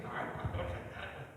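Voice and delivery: breathy scornful whisper of a critic; mocking critics. Critics mocking the pastor for jumping, a scornful whisper. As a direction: sneer it under your breath like a mocker. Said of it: "cuando salto me dicen".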